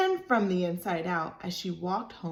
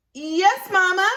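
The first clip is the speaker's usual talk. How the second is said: put-on much higher, louder little girl reply; child voice; 12 semitones higher, 11 dB louder than usual. Libby answering Mama, high childish voice. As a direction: answer like a young girl fibbing.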